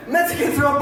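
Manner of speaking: usual speaking voice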